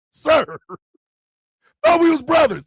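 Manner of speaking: high, loud mock indignation; mock betrayed protest. Jokingly protests at friend's betrayal.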